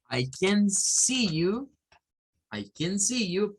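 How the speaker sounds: slow, careful "I can see you" with long "see"; slow model sentence. Shown as a demonstration. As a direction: stretch out "see" like a teacher modeling. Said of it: "no es lo mismo que alguien nos diga". (frase modelo lenta con "see" alargado)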